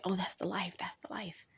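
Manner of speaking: fading into a wistful whisper; envious whisper. Retold or imitated. Imitating people scrolling social media, wistful whisper. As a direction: breathe it like a daydream you envy. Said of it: "they wind up thinking".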